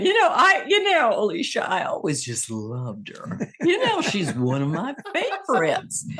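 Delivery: swooping comedienne impression; Carol Burnett. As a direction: leap high, then dip, gushing in a put-on star voice. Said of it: "because of Carol Burnett".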